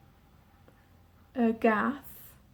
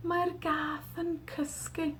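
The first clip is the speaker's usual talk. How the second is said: hushed; 4 semitones higher, 9 dB quieter, slower than usual. Shown as a demonstration. Sleeping cat, hushed.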